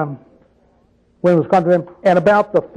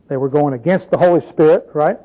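ordinary talk, nothing marked

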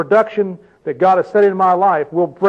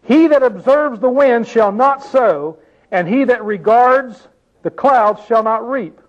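slow, raised reading of the verse; scripture reading; 5 semitones higher, slower than usual. Acted. Preacher reading scripture to the congregation, slow and raised. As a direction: read the verse out slowly like scripture.

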